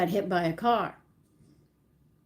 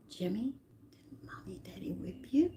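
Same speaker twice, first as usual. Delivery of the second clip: hushed whisper, much softer; secret whisper; 9 dB quieter than usual. Retold or imitated. Kids whispering to injured brother, hushed whisper. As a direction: whisper so the parents can't hear.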